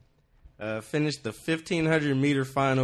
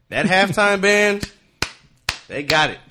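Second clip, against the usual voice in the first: ordinary talk, nothing marked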